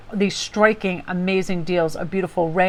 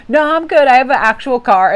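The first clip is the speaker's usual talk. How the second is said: loud, higher-pitched playful protest; emphatic retold refusal; 5 semitones higher, 7 dB louder than usual. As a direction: wave off the suggestion, raised voice. Retelling own laughing refusal to old man.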